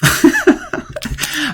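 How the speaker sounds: a burst of high, breathy giggling at his own joke; laughing at his own silly poem; high giggle. Real, not acted. Teacher cracking himself up at his own spoonerized poem, giggling. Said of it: "I'm laughing to myself".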